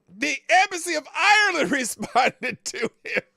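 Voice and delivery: high, loud, breaking into laughter; laughing. Real, not acted. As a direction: crack up while getting the words out. Cracking up at embassy's reply.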